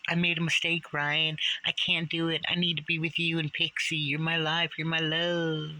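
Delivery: high-pitched, sustained mock pleading. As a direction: plead in a put-on squeaky voice. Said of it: "I can't make my voice go that high".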